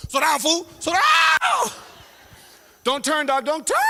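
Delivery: very high, loud mock shriek; panicked skateboarder. Skateboarder towed by bike rope, high panicked shouting. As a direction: yell ahead in hammed-up alarm. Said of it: "So there's that one dude".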